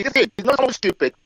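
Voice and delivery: ordinary talk, nothing marked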